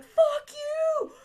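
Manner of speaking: high, loud mock scream of rage; furious yell. Annie screaming at the villain after tearing the check, high furious yell. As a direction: hurl it at him, shrieking. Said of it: "she's like".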